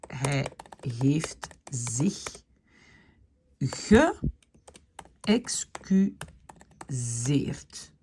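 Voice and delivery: very slow, stretched hij heeft zich geëxcuseerd; slow, careful geëxcuseerd; slow dictation. Shown as a demonstration. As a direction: drag each syllable as you write. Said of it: "saying the words while writing".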